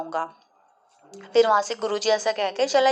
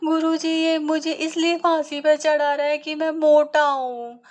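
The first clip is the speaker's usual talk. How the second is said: weeping complaint; 6 semitones higher than usual. Acted. Weeping disciple complains to guru.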